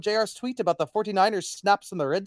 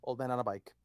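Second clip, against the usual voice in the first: whisper; 7 semitones lower, 11 dB quieter than usual. Real, not acted. Whispered repeat.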